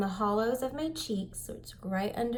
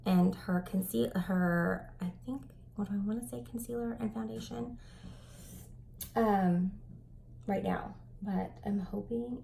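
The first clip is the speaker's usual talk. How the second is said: ordinary talk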